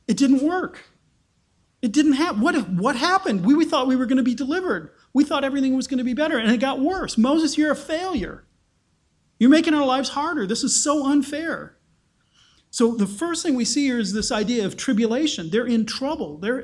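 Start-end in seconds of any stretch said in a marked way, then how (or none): 0.1-7.2 higher, anxious disbelief; voiced dismay. 7.2-11.8 higher, whiny complaint; a hint of an aggrieved crowd; people blaming Moses.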